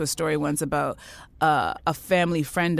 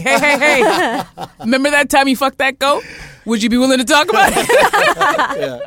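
jokey phone call; 8 semitones higher, 11 dB louder than usual. Pretend. Calling the uncle on the phone, loud and laughing. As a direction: ham up a cheery call to the uncle. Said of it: "I will call Uncle Winston up and be like".

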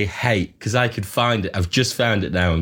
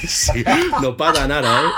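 a light, breezy hint of a Spanish accent; "no pasa nada", tossed off with a relaxed Spanish lilt; mock Spanish accent; 6 semitones higher than usual. Joking with the podcast hosts, a light mock Spanish accent. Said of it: "it's not just a Spanish accent".

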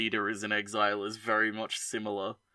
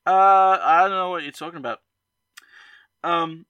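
louder, fast, high fake denial; mock innocent; 6 semitones higher, 8 dB louder than usual. Real, not acted. Cohost dodging the question, in a fast louder fake-innocent voice. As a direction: rattle it off, playing dumb.

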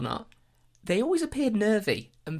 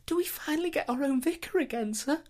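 high, meek, begging villager; pleading villager voice; 13 semitones higher than usual. Acted. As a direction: beg sweetly like a humble peasant. Villagers pleading with new vicar.

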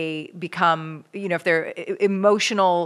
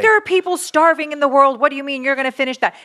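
high, loud, fast parental scold; exasperated mock-parent lecture; 7 semitones higher, 4 dB louder, faster than usual. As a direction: nag like an old-school parent at the dinner table. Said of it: "my parents, hundred percent".

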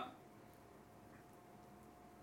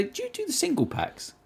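high, light mock asking; imitating himself; 4 dB quieter than usual. Asking the shop worker, in a high mock voice. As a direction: pitch up as he asks politely. Retold own question.

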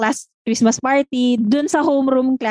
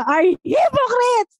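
high, slow imitated scolding; hammed-up teacher's outburst; mock angry teacher; 9 semitones higher, much slower than usual. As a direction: spit it out like a furious teacher. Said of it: "galit na galit talaga siya".

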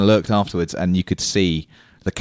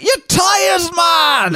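put-on much higher, shouted Scottish accent; 3 dB louder, much slower than usual. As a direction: yell it like an alarmed Scottish mechanic. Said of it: "thick Scottish accent".

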